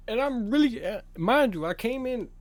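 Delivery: blurting out in shocked outrage; outraged disbelief. Real, not acted. Outraged at unfair suspension.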